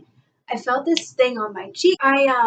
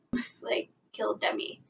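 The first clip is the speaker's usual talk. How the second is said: whisper; 11 dB quieter than usual. Real, not acted. Fan confessing to viewers she almost toppled [name], whispered aside. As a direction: breathe it out like a guilty secret. Confessing near-knockover of idol.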